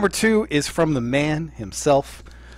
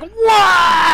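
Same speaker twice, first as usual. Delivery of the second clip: high, loud, drawn-out holler; crowd yell; 9 dB louder than usual. Retold or imitated. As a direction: imitate an amazed crowd roaring. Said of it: "everybody's like".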